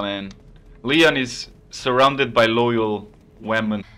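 ordinary delivery

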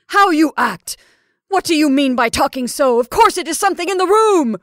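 high, loud scolding; fury. Acted. Caroline scolding Rebecca for talking so, furious. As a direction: rebuke her furiously. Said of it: "turned upon her with a sort of fury".

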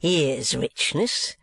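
very slow, smacking the lips. A narrator's reading. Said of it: "smacking his lips".

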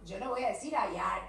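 usual speaking voice

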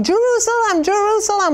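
high, pleading cry of a name. Retold or imitated. Preacher retelling how he cried her name, high and pleading. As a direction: call her back, voice pitched high with longing. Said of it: "And I kept crying".

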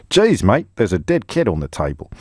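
a jokey Aussie impression thrown in again; Australian accent. Presenter mocking the dead cat line in an Australian accent. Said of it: "the last time I will do an Australian accent".

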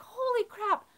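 high-pitched "holy crap" of dismay; the embarrassed mom who just spotted the chaos; shocked exclamation. Retold or imitated. Mom rushing to her climbing son, high dismayed blurt.

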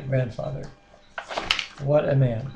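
ordinary talk, nothing marked

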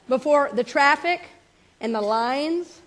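ordinary talk, nothing marked

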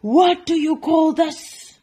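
loud, high, slow, drawn-out bellow; angry roar. Acted. Duke confronting the baker, angry roar. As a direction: roar in indignation. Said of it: "roared the Duke".

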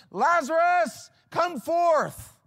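high, drawn-out shouted summons; loud command. Acted. Jesus summons the dead man out, loud command. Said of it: "Calls out in a loud voice".

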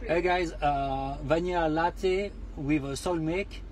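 slow, halting French-accented English; bad French English. Shown as a demonstration. Host orders a latte in clumsy French-accented English. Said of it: "je parle comme une quiche".

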